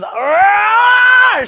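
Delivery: high, loud roaring shout; monster roar. Acted. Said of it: "And Buffy says".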